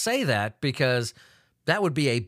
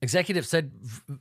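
ordinary talk

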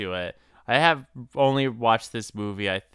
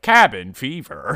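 slow, flat robot voice; 3 semitones higher, 6 dB louder than usual. Acted. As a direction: announce it like a machine. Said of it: "I said that like a robot".

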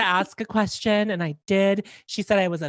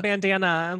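usual speaking voice

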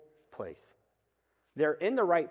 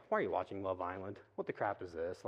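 muttered aside; 7 semitones lower, 8 dB quieter than usual. Retold or imitated. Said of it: "I'm like".

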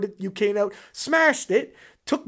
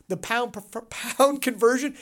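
usual speaking voice

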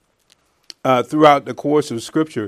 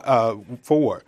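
ordinary delivery

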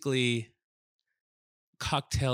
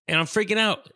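high, loud, worked-up retelling; freaking out; 9 semitones higher, 7 dB louder than usual. Retold or imitated. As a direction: relive the freak-out at full tilt. Retelling panic attack.

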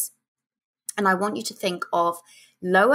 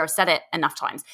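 ordinary talk

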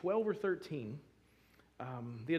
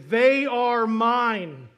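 slow, loud, high tearful cry of love; through tears; 8 semitones higher, 18 dB louder, much slower than usual. Retold or imitated. Family member at the adoption hearing answering the judge, tearful. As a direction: stretch each word out as if choking up. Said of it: "through tears".

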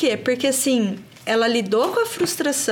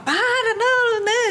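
higher, exasperated outburst; higher, half-laughing exasperation; exasperated self-talk; 12 semitones higher, slower than usual. Retold or imitated. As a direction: snap at yourself as patience runs out. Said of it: "Tinha hora que eu falava".

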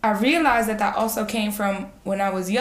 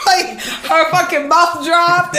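slow, high and loud through laughter; laughing; 7 semitones higher, 7 dB louder, much slower than usual. Real, not acted. Telling friends about mom's shock, laughing loudly. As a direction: laugh it out, half-shouting.

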